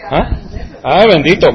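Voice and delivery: ordinary delivery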